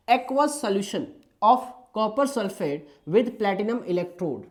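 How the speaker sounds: reading the question out word by word, very slow; slow dictation. Shown as a demonstration. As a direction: spell out the question slowly for students to copy. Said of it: "आराम आराम से भी बोल रहा हूँ मैं". (बहुत धीमे, शब्द-शब्द पढ़कर)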